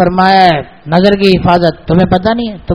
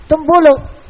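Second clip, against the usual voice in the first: higher, pushy Pathan trader voice; Pathan seller accent; 8 semitones higher, much slower than usual. Retold or imitated. Pashtun carpet seller pressing the imam to name a price, in a Pathan accent. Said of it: "ہمارے پٹھان دوست".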